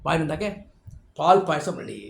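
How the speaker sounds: usual speaking voice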